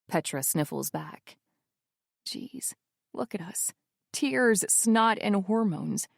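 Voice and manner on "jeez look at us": rueful whisper. A narrator's reading.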